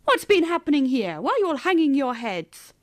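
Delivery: booming, cheery greeting from a bear; big carrying voice of a visitor; loud bear voice. A narrator's reading. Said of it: "spoken in a very loud voice".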